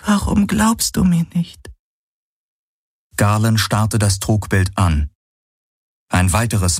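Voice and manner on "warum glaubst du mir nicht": high, pitiful female figure voice; plaintive question. Acted. Illusion pleads with doubting Galen, plaintive. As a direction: beg him to believe you. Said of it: "Sie klang so kläglich".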